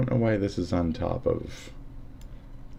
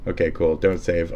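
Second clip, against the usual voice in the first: usual speaking voice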